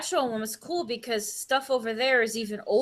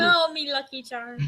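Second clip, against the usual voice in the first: mock Irish Lucky Charms guy; 5 semitones higher than usual. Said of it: "don't go the Lucky Charms guy".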